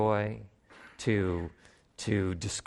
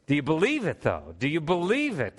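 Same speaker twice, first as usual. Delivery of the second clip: normal talking voice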